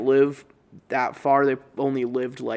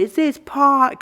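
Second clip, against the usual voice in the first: high, loud shrieked outburst; mom freaking out; 12 semitones higher, 7 dB louder, slower than usual. Retold or imitated. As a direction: shriek it in shocked rage. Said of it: "Starts losing her shit".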